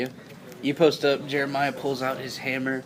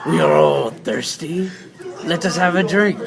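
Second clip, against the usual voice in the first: hobgoblin proclamation; 3 semitones higher, 6 dB louder than usual. Acted. Hobgoblin accepting ales for his thirsty dorks, loud proclamation. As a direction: declare it heartily to the whole band.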